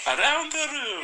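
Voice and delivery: high-pitched attempt at a Scottish accent. Shown as a demonstration. Showing co-host his key phrase, hammed-up Scottish accent. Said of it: "Scottish I can do".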